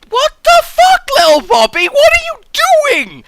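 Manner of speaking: very high, loud, disbelieving shriek; shocked yell. Real, not acted. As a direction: scream in laughing disbelief. Shocked at flying player.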